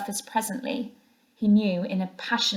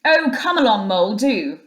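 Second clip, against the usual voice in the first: louder, breezy call over the shoulder; hearty friend calling back; cheerful; 7 dB louder than usual. A narrator's reading. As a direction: brush it off cheerfully. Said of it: "replied the rat cheerfully".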